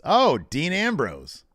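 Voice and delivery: slow, high-pitched quote of recognition; imitating Lacey's remark. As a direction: call it out high and drawn out. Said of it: "She says".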